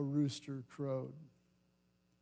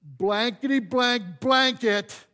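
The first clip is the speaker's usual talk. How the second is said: slow, loud mock swearing; hammed-up cussing with the swear words bleeped; mock cursing; 6 semitones higher, 8 dB louder, slower than usual. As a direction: spit out a bleeped curse slowly. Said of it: "to swear".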